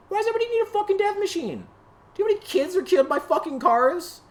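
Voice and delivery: very high, fast, shrill-pitched outraged shout; shrieking yell. Real, not acted. Ranting about cars at the camera, a shrieking high yell. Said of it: "I'm just yelling at a fucking camera".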